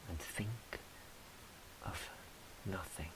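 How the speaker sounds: hushed whisper fading to almost nothing; soft whisper, trailing into silence. Acted. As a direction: breathe it out, drifting off. Dreamy surrender to virginity.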